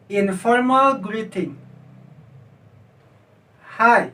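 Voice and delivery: "informal greeting" and each greeting after it drawn out carefully; careful read-and-repeat drill, falling tune on each phrase; pronunciation model. Shown as a demonstration. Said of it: "perhatikan cara bacanya".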